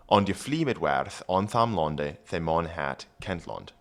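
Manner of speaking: put-on Old English read aloud; careful Old English reading. Shown as a demonstration. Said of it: "reads Old English".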